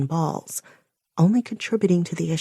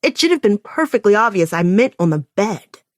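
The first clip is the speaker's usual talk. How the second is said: loud, cutting reprimand; harsh tone; 3 semitones higher, 7 dB louder than usual. Acted. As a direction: scold him sharply and impatiently. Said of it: "the harsh tone of my voice".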